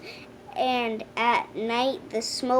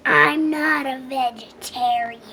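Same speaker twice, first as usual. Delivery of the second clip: roared; 3 dB louder than usual. A narrator's reading. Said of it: "roared the dragon".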